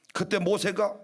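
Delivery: usual speaking voice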